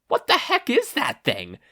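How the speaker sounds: high, loud startled yell; young hero yelling at a creature. Acted. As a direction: shout in shock at the monster. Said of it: "Izuku couldn't help but yell".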